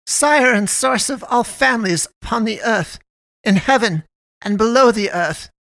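ringing invocation, pitched high; solemn mother. Acted. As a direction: proclaim it like a prayer to a god.

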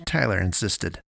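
ordinary delivery

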